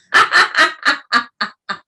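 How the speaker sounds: real laugh after the chant; hearty laugh. Real, not acted. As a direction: laugh freely and loudly. Laughter exercise.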